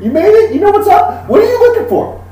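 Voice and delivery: very high, laughing buddy talk; giggly gun dealer. Acted. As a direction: chuckle and butter up the customer.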